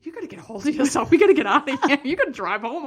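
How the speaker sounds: a lightly voiced husband telling her to pull herself together; husband urging. Husband telling his sobbing wife to get it together, mock urgent. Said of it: "He's like".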